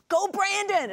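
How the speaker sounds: put-on high-pitched cheer for a kid; acted sideline yell. Parent cheering a son at a game, acted yell. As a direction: call out like a proud sports parent. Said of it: "who can't yell now for their".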